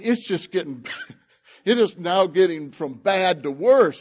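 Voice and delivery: ordinary talk, nothing marked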